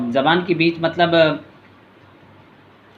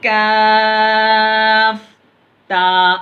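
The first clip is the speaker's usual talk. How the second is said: slow, high, louder chanted lam alif; drawn-out recitation; 6 semitones higher, 5 dB louder, much slower than usual. Shown as a demonstration. Teacher demonstrates the lesson's letters to pupils, slow chanted and louder. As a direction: hold लाम very long, then a falling अलिफ. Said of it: "ये दो हरफी है".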